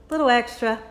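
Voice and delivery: usual speaking voice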